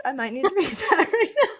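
high laughing joke. Real, not acted. Joking with friend about her depression, laughing. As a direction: laugh it off as a self-mocking quip. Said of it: "love to make a light of mental health".

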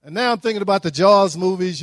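usual speaking voice

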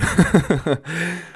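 short high chuckle; a high, rueful little laugh; amused laugh. Real, not acted. Podcaster on people not thinking like you, laughing.

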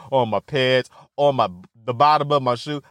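ordinary talk, nothing marked

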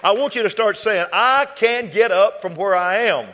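full-force preaching. Real, not acted. Preacher urging the house to claim it, full-force preaching. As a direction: preach it at full force, stretching the big words. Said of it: "Can I preach a little bit".

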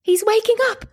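high-pitched, urgent news; excited announcement. A narrator's reading. Matron tells the mother her son wakes, excited high voice.

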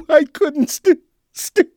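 laughing and sobbing at once, high and loud; falling apart, stammering through laughter and tears; hysterical laugh-crying. Real, not acted. [name] to his analyst, helpless laughing sobs. Breaking down while confessing to analyst.

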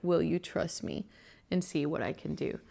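ordinary delivery

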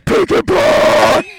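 shout. Pretend. Blooper shouting food names.

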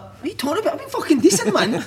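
high-pitched incredulous comeback, laugh in it; imitated protest. Player told he has five minutes, high incredulous protest.

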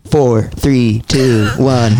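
imitated automated countdown; lightly voiced computer countdown; computer count-in. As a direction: count evenly, like a machine. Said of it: "a computer-generated voice".

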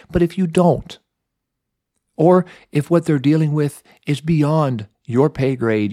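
normal talking voice

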